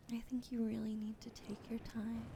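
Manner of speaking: usual speaking voice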